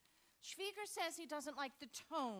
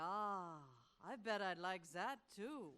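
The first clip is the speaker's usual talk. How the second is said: mock German accent; 5 semitones lower, slower than usual. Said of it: "that German accent".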